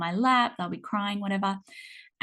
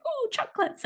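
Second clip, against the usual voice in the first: put-on delighted kid. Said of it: "they're gonna go".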